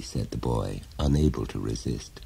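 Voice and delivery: ordinary delivery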